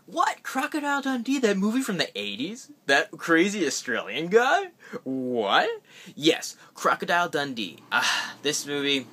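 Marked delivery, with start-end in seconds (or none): 0.0-5.7 high squeaky disbelief on each question; shocked viewer. Pretend. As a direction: act baffled by such a movie choice. Imagined viewer questioning him.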